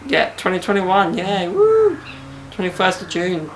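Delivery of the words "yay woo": high-pitched party whoop; playful high cheer; mock celebration. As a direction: give a hammed-up little cheer. Mock cheer for lockdown ending.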